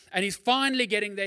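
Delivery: ordinary talk, nothing marked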